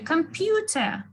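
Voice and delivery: computer with a high, stressed PEW. Shown as a demonstration. Teacher drilling the stressed syllable, computer with stress on PEW. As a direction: lift and stretch the PEW in computer.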